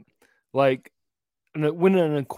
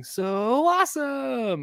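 high, very drawn-out singsong; cartoon cat catchphrase; 9 semitones higher, much slower than usual. Dad echoing the twins' cartoon, high singsong drawl. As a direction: stretch it like a cartoon cat cheer. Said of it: "I find myself going".

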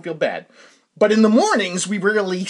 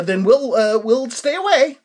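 higher, louder, slower mock-polite retreat; polite backing off; 8 semitones higher, 7 dB louder, slower than usual. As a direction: back off with exaggerated politeness. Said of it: "I said, uh".

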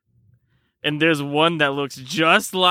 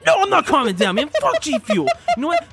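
ranting; 13 semitones higher, faster than usual. Acted. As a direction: go off ranting high. Ranting refusal to calm.

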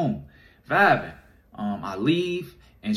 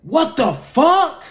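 high, loud, slow shocked cry; retold stunned yell; stunned outburst; 9 dB louder, slower than usual. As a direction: stretch it out in shock. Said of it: "I'm like".

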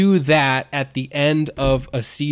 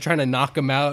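ordinary talk

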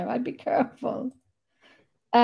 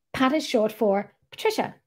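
ordinary delivery

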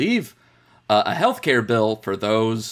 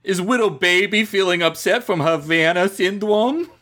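uwu speak; 5 semitones higher than usual. Pretend. Mocking baby voice.

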